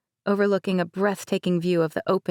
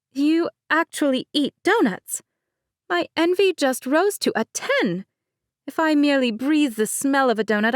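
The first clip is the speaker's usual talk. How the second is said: light higher-pitched bride, laughing it off; Mercedes voice; 6 semitones higher than usual. Acted. Bride Mercedes teasing her cousin, higher light voice. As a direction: play the bride breezily.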